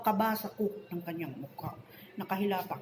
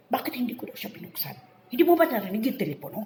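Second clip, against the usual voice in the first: high, quickening mock tirade, louder at the end; retold angry scolding; 4 semitones higher, 6 dB louder, faster than usual. Co-worker scolds housemate over the unanswered phone, angrily retold. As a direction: imitate an angry co-worker firing accusing questions. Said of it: "galit na galit siya".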